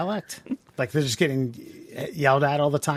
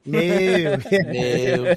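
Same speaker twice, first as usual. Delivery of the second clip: mock cow moo; 3 dB louder than usual. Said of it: "I have a shitty Baltimore accent".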